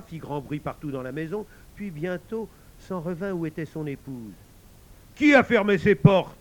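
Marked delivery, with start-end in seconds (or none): 5.2-6.4 roared demand, much louder and higher; roaring. Acted. Husband roars at his wife over the locked doors, furious shout. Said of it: "rugit-il".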